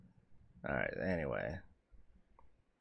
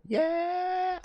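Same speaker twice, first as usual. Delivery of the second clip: very high gleeful whoop; victory whoop. Real, not acted. Player whooping over seventy-seven gold. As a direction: whoop like you hit the jackpot.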